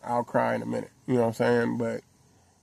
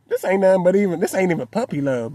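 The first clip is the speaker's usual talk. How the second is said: higher, louder protest at first; mild disbelief; 7 semitones higher, 6 dB louder than usual. Retold or imitated. Father recalling his daughter's puppy love tears, mildly disbelieving. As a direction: protest it as your own startled thought.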